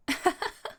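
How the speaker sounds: a quick amused laugh; short laugh. Acted. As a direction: burst out laughing briefly. Amused at the warning.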